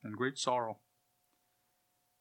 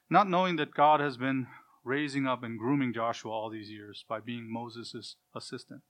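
ordinary delivery

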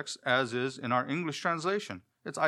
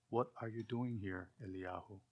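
much softer, hushed voice of God; thin whisper voice; 4 semitones lower, 14 dB quieter than usual. Acted. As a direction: barely breathe the question as God's still small voice. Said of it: "a voice of a thin whisper".

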